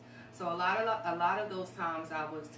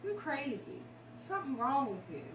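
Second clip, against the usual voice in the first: higher, mocking accusing voice; harsh accusation; 8 semitones higher than usual. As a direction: throw the old insult back, sharply. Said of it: "all my life I heard".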